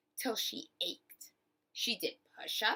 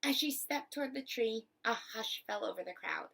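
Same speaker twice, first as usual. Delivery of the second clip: ordinary delivery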